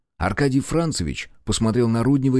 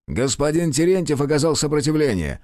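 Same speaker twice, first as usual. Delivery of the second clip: higher, insolent officer's reply; a brazen gendarme, higher pitched; 7 semitones higher than usual. A narrator's reading. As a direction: brush off the charge brazenly. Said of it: "нагло ответил жандармский офицер".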